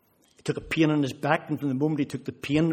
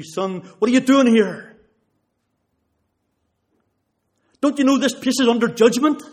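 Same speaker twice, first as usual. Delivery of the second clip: powerful street preaching; 10 semitones higher, 9 dB louder than usual. Retold or imitated. Stranger in the red light district warns him, loud preaching. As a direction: thunder the warning like a street preacher. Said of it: "he just gave me the gospel as powerfully as I've ever heard".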